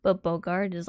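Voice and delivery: ordinary talk, nothing marked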